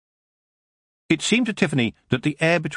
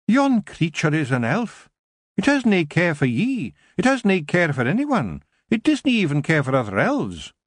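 much higher, Scottish brogue, gruff little Feegle; a wee Scottish warrior scoffing at the elf; tiny Scottish Feegle grumbling about elves; 8 semitones higher than usual. A narrator's reading. Feegle Kelder warns Tiffany.

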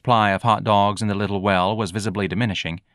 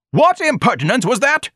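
high, loud pompous indignation; outraged scream; 6 dB louder than usual. A narrator's reading. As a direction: shriek it in offended dignity. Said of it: "Ignatius screamed".

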